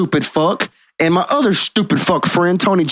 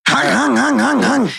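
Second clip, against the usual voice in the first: imitated gunshots; 5 semitones higher, slower than usual. Imitating gunshots through the glass.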